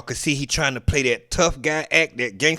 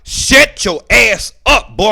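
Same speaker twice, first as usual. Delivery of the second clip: mock stern shout; 6 semitones higher, 8 dB louder, slower than usual. Voicing the judge's subtext.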